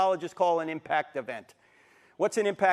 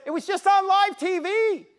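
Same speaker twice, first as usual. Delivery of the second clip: agitated pastor; 13 semitones higher, 7 dB louder than usual. Retold or imitated.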